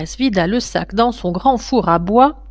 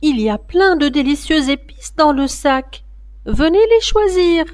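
put-on girl crying out; 8 semitones higher than usual. A narrator's reading. Wardé crying out to the ogress, high and urgent. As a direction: stop her before it is too late. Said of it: "Wardé s'écria".

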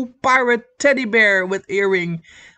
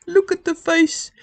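higher, brighter gush over the face; delighted squeal; 8 semitones higher than usual. Real, not acted. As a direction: gush like seeing a cute puppy. Cooing over a crochet doll.

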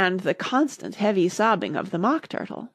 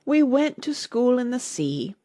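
higher, mournful Mock Turtle voice; slightly sobbing; 6 semitones higher than usual. A narrator's reading. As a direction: reminisce with a touch of a wail. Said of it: "though still sobbing a little".

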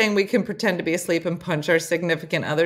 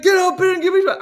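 high, loud pretend command; mock drill order; 12 semitones higher, 7 dB louder, faster than usual. Imagining somebody waking a sleeper, mock yelled order. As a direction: yell it like a pretend sergeant. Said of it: "If somebody was like".

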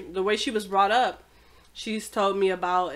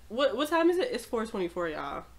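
usual speaking voice

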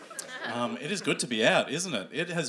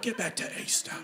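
high, softer indignant shooing; mock scolding; 10 semitones higher, 6 dB quieter than usual. Comic telling November buns off, mock scolding. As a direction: shoo it off in mock outrage.

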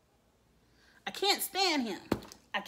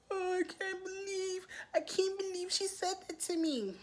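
higher, softer mock Karen whimper; whiny Karen; 9 semitones higher, 4 dB quieter than usual. Mocking a Karen caught out after starting trouble, whining higher and softer. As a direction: play the wounded Karen, hurt and pitiful. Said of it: "the picture of a Karen".

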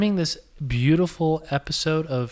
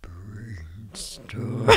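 eerie breathy whisper; creepy whisper; 7 semitones lower, 11 dB quieter than usual. Shown as a demonstration. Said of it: "this is just getting creepy".

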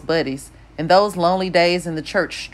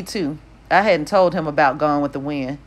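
ordinary delivery, nothing marked

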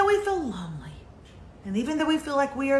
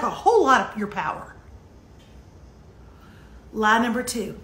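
usual speaking voice

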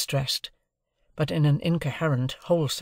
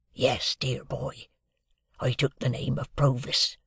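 a lightly voiced, hushed old convict; Magwitch the convict. A narrator's reading. Convict answering Pip at breakfast, lightly voiced and hushed. Convict figure in read novel.

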